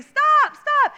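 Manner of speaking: put-on high, loud child's yell; yelling stop. Boy waving at bulldozer, high shout. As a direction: shout it high like a scrawny kid.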